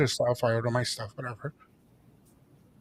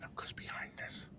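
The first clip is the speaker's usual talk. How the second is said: hushed, unvoiced whisper; secretly tipping off his mom; 21 dB quieter than usual.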